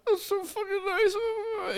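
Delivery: very high, drawn-out mock weepy voice; tearful, touched parent, played for laughs; trying not to cry. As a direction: squeak it out as if choking up, hammed-up. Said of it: "trying not to cry".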